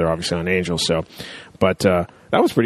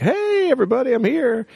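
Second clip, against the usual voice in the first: high-pitched sing-song hello; mock cheery greeting. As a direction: announce yourself in a silly high voice. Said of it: "he doesn't say hi, and it's like".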